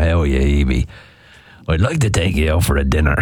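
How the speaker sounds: low mock Dublin suitor; Dublin accent. Host plays someone sliding into Hebe's DMs, Dublin accent. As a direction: chat her up in a deep put-on Dublin voice. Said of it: "If someone slid into your D Ms".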